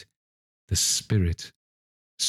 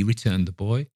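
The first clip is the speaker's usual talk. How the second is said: usual speaking voice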